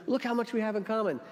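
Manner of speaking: high-pitched mock delight; delighted joke character. Joke teller voicing a would-be rescuer, delighted. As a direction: play up the eager bonding. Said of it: "It's like the joke".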